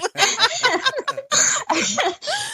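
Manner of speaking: a high, real burst of laughter; laughing. Real, not acted. Mother laughing at her own confession, a high burst of laughter. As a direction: let a high laugh break out. Laughs at husband going through it.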